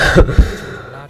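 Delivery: real laugh. Real, not acted. Laughs at own fear.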